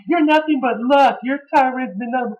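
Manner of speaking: high-pitched mocking imitation; Michael Cole. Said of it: "Cole was getting on my boy D. Bron saying".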